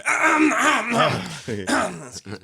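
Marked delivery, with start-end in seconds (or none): 0.1-1.3 loud high fake ahems; pointed throat clearing. Annoyed moviegoer signalling the giggler, loud throat clearing. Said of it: "And so I go".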